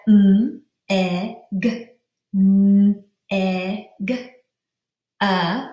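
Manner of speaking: "m", "e", "g" as isolated sounds, then "uh"; each sound of "m, e, g, uh" said apart. Shown as a demonstration. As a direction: hum "m", short "e", hard "g", schwa "uh". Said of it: "pound out the syllables".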